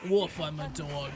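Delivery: a hint of a talking dog. Shown as a demonstration. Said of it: "if talking means bark".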